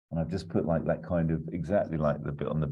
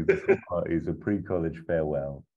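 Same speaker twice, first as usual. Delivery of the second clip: normal talking voice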